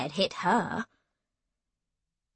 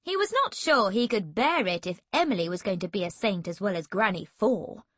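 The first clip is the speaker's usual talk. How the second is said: plain reading voice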